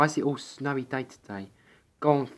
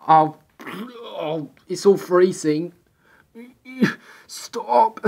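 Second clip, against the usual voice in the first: panicked squeal; 7 semitones higher, 6 dB louder, much slower than usual. Acted. Caught in freezing snow.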